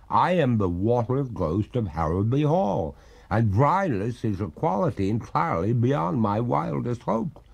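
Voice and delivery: slow, mournful water ghost; weary spirit of two centuries. Acted. Ghost explains herself.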